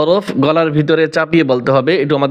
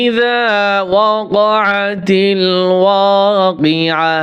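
slow, drawn-out tajweed chant; Quran recitation; 3 semitones higher, much slower than usual. Shown as a demonstration. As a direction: recite each word with long held vowels. Recites the verse. (ধীরে টেনে টেনে কোরআন তিলাওয়াত)

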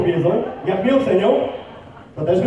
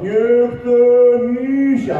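very slow, stretched-out whale call; whale-speak; 2 semitones higher, 3 dB louder, much slower than usual. Shown as a demonstration. Trainer demonstrates saying an excuse aloud, in slow whale-speak. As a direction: draw every vowel out long like a whale. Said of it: "mówić na głos po waleńsku swoją wymówkę".

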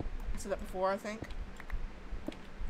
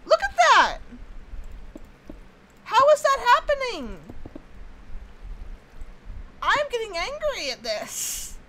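very high, loud indignant cry; outraged disbelief; 11 semitones higher, 5 dB louder than usual. Real, not acted. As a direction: shriek at the ridiculous glitch. Outraged at water glitch.

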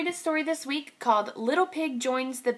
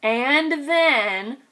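plain reading voice

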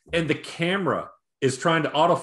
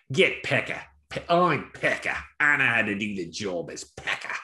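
put-on Irish Cockney accent; 3 semitones lower than usual. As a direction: put on a rough attempt at Cockney. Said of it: "it's a real Irish Cockney accent".

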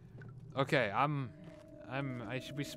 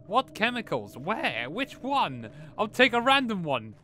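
high, loud, bewildered questioning; exasperated; 9 semitones higher, 9 dB louder, slower than usual. Real, not acted. Player unsure which chemicals the game wants, exasperated. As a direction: blurt it out loudly, fed up with the puzzle. Confused by the game's chemical puzzle.

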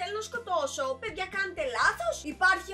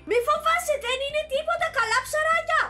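mock reassurance; 8 semitones higher, 5 dB louder than usual. Coaxing Sim toward sharks.

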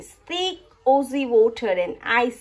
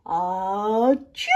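sneeze; 2 semitones lower than usual. Acted. Sneeze in red dust.

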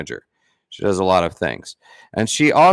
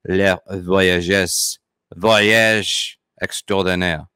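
slow, drawn-out poor French accent; cartoonish French accent; much slower than usual. Real, not acted. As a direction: labour over the French name, almost cartoonishly. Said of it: "almost cartoonish when I say it with my poor French accent".

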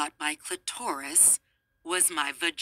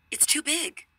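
much higher, pushed repeat of "It's too big"; higher insistent repeat; 9 semitones higher than usual. Retold or imitated. Girl repeating to her friend who didn't hear, pitched high.

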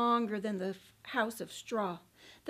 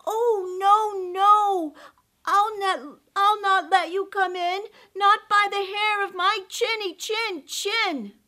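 high, loud frightened squeal; scared little pig; 7 semitones higher, 9 dB louder than usual. Acted. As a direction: cry out in panic. Pig refuses the wolf.

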